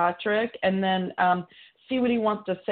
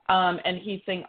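normal talking voice

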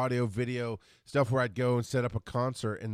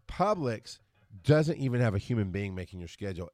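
usual speaking voice

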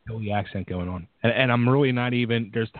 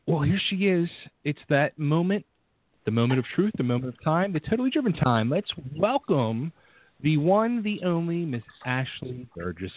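announcer introduction; 4 semitones higher than usual. Acted. Introduces the guest as show host.

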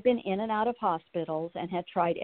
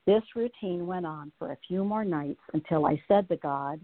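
ordinary talk, nothing marked

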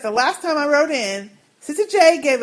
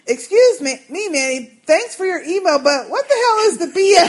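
lightly voiced Sister J, confused and rising into laughter; a hint of Sister J, half laughing at the email; quoting Sister J; 5 semitones higher, 4 dB louder than usual. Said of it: "when she said".

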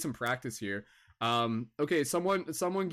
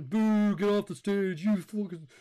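higher, louder jeering heckler voice; mock heckler crowd; 6 semitones higher, 5 dB louder than usual. Comic heckling himself off stage with boos. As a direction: boo yourself off like a rowdy crowd.

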